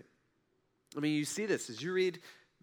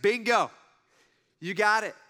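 loud, excited praise; enthusiastic approval; 3 semitones higher, 6 dB louder than usual. Retold or imitated. Jesus praising Peter for the right answer, excited. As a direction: cheer him on.

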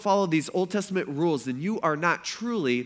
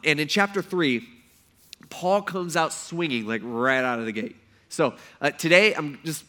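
ordinary talk, nothing marked

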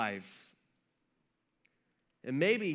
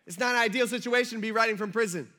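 louder, higher, quick insistence; emphatic restatement; 4 semitones higher, 5 dB louder, faster than usual. Real, not acted. Preacher answers unsure listeners about prison, emphatic restatement. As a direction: spell it out briskly and louder.

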